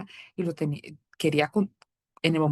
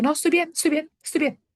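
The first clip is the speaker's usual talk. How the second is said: high, loud insistent "estoy bien"; fake fine; 10 semitones higher, 3 dB louder, faster than usual. Wife insisting to husband she's fine, mock brightly. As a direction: brush it off, repeating "bien" brightly.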